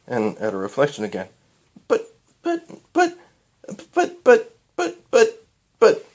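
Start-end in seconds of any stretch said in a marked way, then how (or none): 1.9-6.1 high panicked stammer; much higher, louder stuttering young mare. Acted. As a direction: splutter in shock. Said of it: "stuttered Cadance".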